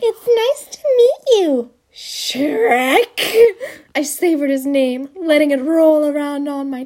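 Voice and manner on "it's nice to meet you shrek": high, slow swooning greeting; lightly voiced smitten woman. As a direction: savor his name dreamily. Dreamy flirtatious greeting.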